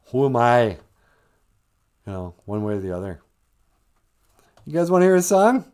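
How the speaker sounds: ordinary talk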